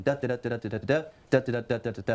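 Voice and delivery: da da da chanted in iambic beat; sing-song da da beats; iambic rhythm. Shown as a demonstration. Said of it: "it follows this rhythm".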